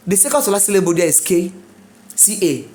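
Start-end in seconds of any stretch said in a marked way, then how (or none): none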